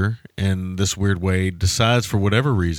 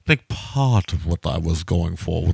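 lightly voiced portly English gentleman; Hitchcock voice; 2 semitones lower, slower than usual. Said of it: "That's about as good as I can do".

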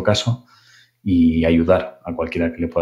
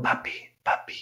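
soft eerie whisper; 13 dB quieter than usual. Retold or imitated. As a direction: breathe it out like birds hissing in the dark. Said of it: "están susurrando".

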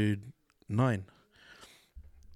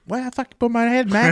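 higher, louder protest in broken English; 12 semitones higher, 7 dB louder than usual. Retold or imitated. Retelling a comedian's dad in a prank, broken English. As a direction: imitate an indignant dad's broken Vietnamese English. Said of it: "his English is real broken".

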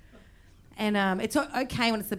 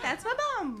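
put-on high, softer baby voice; jokey baby talk; 8 semitones higher, 5 dB quieter than usual. Shown as a demonstration. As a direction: repeat it small, high and cutesy. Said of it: "like, being funny".

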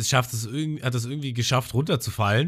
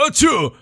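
loud, high sea-king sneeze; huge fake sneeze; 10 dB louder than usual. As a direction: blast out a giant sneeze. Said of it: "so kurz vorm Niesen ist". (lautes, hohes Riesenniesen)

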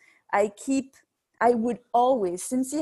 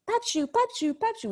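put-on high little-girl voice calling out; sing-song toddler calling for her grandpa; 13 semitones higher than usual. Mother imitating her toddler calling for grandpa, high and sing-song.

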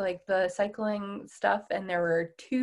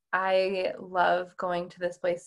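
usual speaking voice

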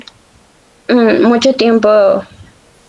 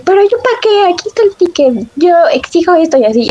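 mock angry mother; 8 semitones higher than usual. La mamá le grita enojada.

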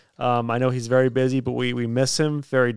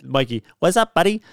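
cheery greeting rising high on buddy; hammed-up friendly hello, pitch jumping up at the end; imitating Mikey; 3 semitones higher than usual. Host mimics Mikey's greeting, high rising buddy. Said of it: "That sounds nothing like me".

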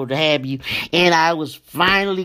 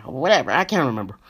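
ordinary delivery

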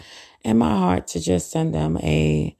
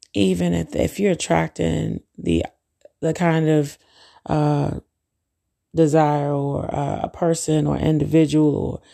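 usual speaking voice

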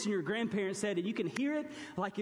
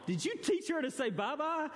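higher, exclaiming at his wife; mock outrage; 5 semitones higher than usual. Husband asks wife Dawn in high mock outrage. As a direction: accuse her in comic alarm. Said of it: "I was like".

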